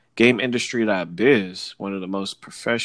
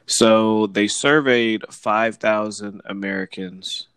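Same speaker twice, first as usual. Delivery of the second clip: ordinary delivery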